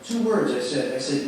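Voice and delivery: ordinary delivery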